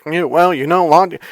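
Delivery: a hint of a smug know-it-all; mocking the guy. Mocking the irritating man's logic talk, mild smug voice. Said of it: "you should have heard this guy".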